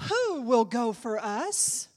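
slow, loud question rising at the end; measured preached call. Acted. First take of repeated call.

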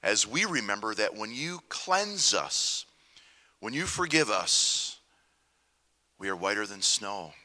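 soft, reverent prayer voice. Acted. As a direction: pray quietly and humbly. Opening prayer to the Lord.

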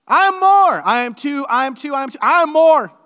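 Paul, voiced by the preacher, bragging at full volume; foolish boast. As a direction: shout your superiority like a fool. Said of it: "gonna go super foolish here".

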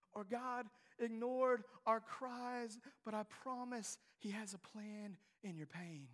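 usual speaking voice

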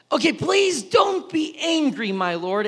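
high, loud and slow pleading. Acted. Abraham begging the Lord, loud pleading. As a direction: beg him not to be angry. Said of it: "Abraham pleaded".

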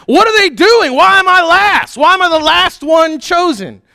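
high, loud whining protest; indignant yelling. Retold or imitated. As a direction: shout the outrage of being picked last.

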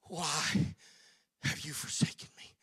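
breathless whisper of the dying Christ; barely a breath, forsaken; whispered cry. Acted. Jesus on the cross asking God why, whispered.

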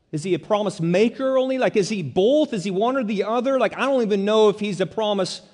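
rushed, nervous questioning; anxious doubting voice. Pretend. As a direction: voice the doubts of a fearful heart. Voicing the doubting heart, pitched up.

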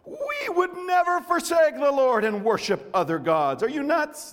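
excited crowd. Acted. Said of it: "the people replied".

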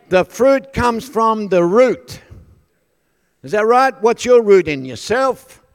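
loud, high, emphatic preaching; preaching at full force. Real, not acted. Preacher teaches congregation about fruit and root, forceful preaching. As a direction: drive the scripture home to the crowd.

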